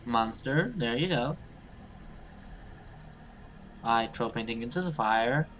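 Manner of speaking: normal talking voice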